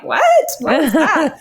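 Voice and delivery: mock baffled double take; puzzled reader. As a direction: play a reader jolted out of the story. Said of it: "And they're like".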